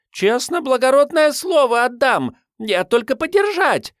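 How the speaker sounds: high, pleading urchin voice; street urchin girl. Acted. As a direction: swear it like a street kid. Urchin promising to return.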